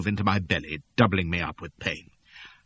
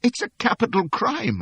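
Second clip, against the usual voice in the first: whimpering; 3 dB louder than usual. Acted. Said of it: "I whimpered".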